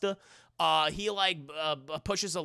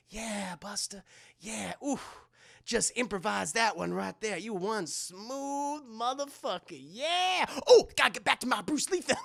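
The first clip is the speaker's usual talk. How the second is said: self-admiring [name]; 3 semitones higher than usual. Retold or imitated. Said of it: "talk to himself".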